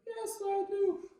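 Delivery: put-on high, slow sing-song kid voice; little boy voice. Nine-year-old boy asks the trumpeter to sign a plunger, in a high sing-song kid voice. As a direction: play an eager nine-year-old asking sweetly. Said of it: "he's like".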